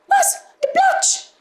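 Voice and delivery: very high frightened squeak; startled mice. A narrator's reading. Mice hearing of the Platsch, startled high squeaking. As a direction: squeak it out in alarm. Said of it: "ganz verschrocken gerufen".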